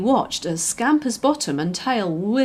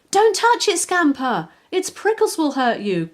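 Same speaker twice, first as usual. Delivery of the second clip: frantic warning; 7 semitones higher than usual. A narrator's reading. Butterfly warns the puppy off the hedgehog, frantic. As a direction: warn him before it is too late. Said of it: "cried Blossom frantically".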